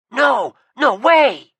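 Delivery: loud, slow, high denial; No, no way drawn out in a loud outraged cry; indignant shout. Acted. Seth denies writing the message.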